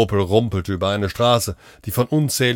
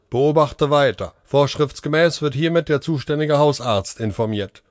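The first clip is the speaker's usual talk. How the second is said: higher, evenly paced machine report; robot voice; 6 semitones higher than usual. A narrator's reading. Care robot reporting the patient's symptoms, mechanical voice. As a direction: read the findings like a speaking care robot. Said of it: "meldete eine Roboterstimme".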